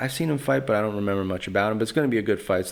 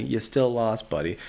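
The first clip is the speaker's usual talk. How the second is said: ordinary talk, nothing marked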